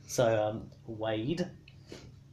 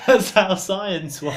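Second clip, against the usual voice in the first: slow, higher, louder, laughing through it; 10 semitones higher, 16 dB louder, slower than usual. Real, not acted. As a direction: insist while cracking up. Insisting while laughing.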